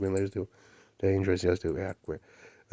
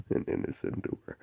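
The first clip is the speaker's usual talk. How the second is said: whispered and softer; whispered quote of an innocent dweller; 7 dB quieter than usual. Retold or imitated. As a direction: breathe it out as a secret. Whispered quote, then echoed.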